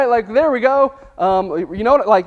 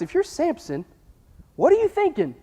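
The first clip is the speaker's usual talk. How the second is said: ordinary talk, nothing marked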